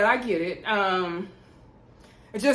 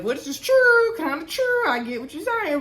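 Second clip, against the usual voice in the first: teasing high voice; 7 semitones higher, 3 dB louder than usual. Real, not acted. Blogger half-sides with Brandon in an aside, teasing high voice. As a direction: squeal it out, then ease down in pitch.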